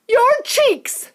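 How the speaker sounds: very high piercing scream. A narrator's reading. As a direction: scream in horror. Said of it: "screamed Missus Beauregard".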